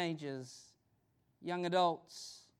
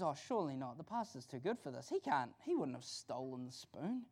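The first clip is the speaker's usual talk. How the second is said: lower, softer disbelieving reply; lightly voiced husband; 5 semitones lower, 6 dB quieter than usual. Husband defends the pastor to his wife, mildly disbelieving. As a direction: protest softly in a lower voice.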